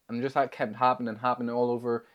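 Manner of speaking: usual speaking voice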